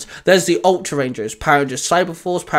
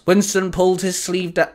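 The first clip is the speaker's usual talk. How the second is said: ordinary delivery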